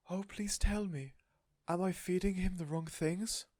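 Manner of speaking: high, slow plea; pleading. A narrator's reading. Missus Silver begging Mister Hoppy, pleading. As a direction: beg anxiously.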